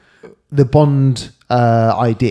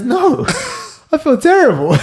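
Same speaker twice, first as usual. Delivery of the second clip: laughing. Retold or imitated. Retold villain's line, laughing.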